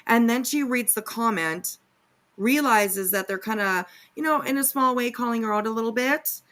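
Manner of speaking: normal talking voice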